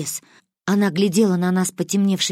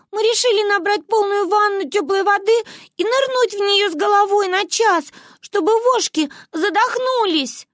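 put-on high, childish earnest explaining; earnest girls; 14 semitones higher, 3 dB louder than usual. A narrator's reading. Girls explaining their lice plan to grandmother. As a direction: explain it like a proud little girl.

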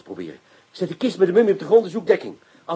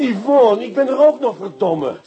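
loud, raised, jealous protest; exasperated outburst; 4 semitones higher, 7 dB louder, slower than usual. Acted. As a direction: demand her attention, cursing. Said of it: "Sta je nou te schelden?".

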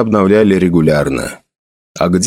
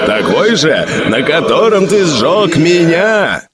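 a hint of a burning father's cry, slowed; father's cry from the flames; 6 semitones higher, slower than usual. Burning father accuses his son, mild pained cry. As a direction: drag the words out in pain. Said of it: "выкрикнул отец и заорал от боли".

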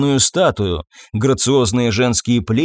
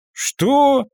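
breathed out in shock; 12 semitones higher than usual. Acted. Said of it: "выдохнул".

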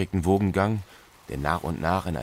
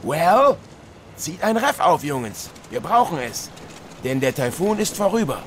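captain's orders; 11 semitones higher than usual. Acted. Captain orders crew after storm.

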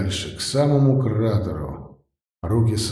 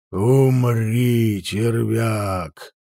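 huge fiery dragon roar; much slower than usual. A narrator's reading. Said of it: "заревел дракон".

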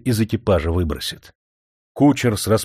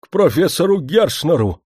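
breathless from hurrying; urgent panting report; 8 semitones higher, 4 dB louder than usual. A narrator's reading. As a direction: blurt it out out of breath. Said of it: "задыхаясь от спешки, выпалил".

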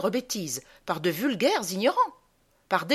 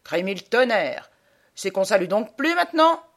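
put-on loud, indignant old sergeant; old army sergeant taking offence; 5 dB louder than usual. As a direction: call out the rebuke as a drill sergeant would. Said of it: "Un vieux sergent me dit".

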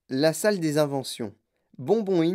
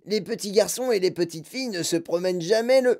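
put-on boy objecting; 3 semitones higher than usual. A narrator's reading. Mike Teavee interrupting Wonka with an objection. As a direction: object like a know-it-all boy. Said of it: "dit Mike Teavee".

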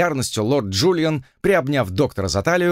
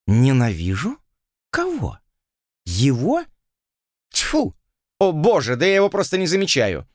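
indignant scoff; 4 semitones higher than usual. A narrator's reading. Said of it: "сумела взять себя в руки".

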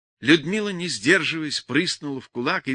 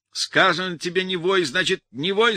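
higher and louder, building to a yell; angry shout; 5 semitones higher, 5 dB louder than usual. A narrator's reading. Brother yells at wailing Lyudmila, building loud shout. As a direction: bark her into silence. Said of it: "закричал на неё Борис".

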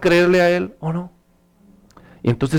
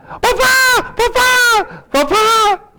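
high-pitched imitation of a child's shout; panicked child; much slower than usual. Child alone in the dark calls papá, mock panic. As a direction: cry out for dad like a frightened child.

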